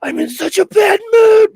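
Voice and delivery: loud, high exasperated cry; frustrated shout. Retold or imitated. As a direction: yell it in frustration. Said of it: "and I was like".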